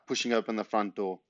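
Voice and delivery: ordinary delivery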